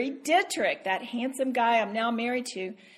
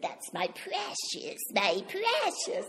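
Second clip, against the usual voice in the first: lightly voiced creepy creature, high and hushed; a hint of Gollum crooning over his ring; 6 semitones higher, 5 dB quieter, slower than usual. Preacher imitating Gollum for the congregation, creepy and hushed. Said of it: "That's pretty creepy".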